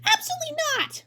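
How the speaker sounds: high indignant cry; angry refusal. Acted. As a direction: refuse outright, furious. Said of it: "Now Ludwig looked angry".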